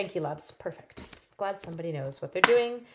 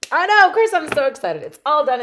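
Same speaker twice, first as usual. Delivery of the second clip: higher and louder, bubbling over; excited; 9 semitones higher, 12 dB louder than usual. Real, not acted. Telling viewers about the property, giddy. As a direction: burst out with glee.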